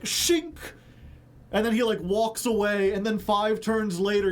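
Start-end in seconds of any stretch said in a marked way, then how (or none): 0.0-0.5 high, sharp "Shink!" like a blade drawn; mock sword shink. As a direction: snap out a cartoon blade sound. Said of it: "he's like".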